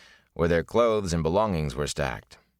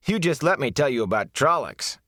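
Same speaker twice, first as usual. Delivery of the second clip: higher, keen storyteller voice; eager young braggart; 8 semitones higher than usual. Acted. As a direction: lean in to boast. Mat eager to tell.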